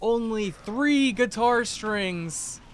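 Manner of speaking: high, slow pitchman voice; infomercial. Acted. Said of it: "an infomercial voice".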